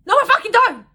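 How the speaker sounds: high, loud furious retort; furious reply. Real, not acted. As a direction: snap back in outrage. Retorting to his text.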